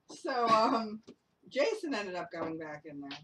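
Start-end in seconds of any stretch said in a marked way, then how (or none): none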